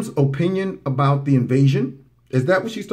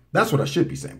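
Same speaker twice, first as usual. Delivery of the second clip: normal talking voice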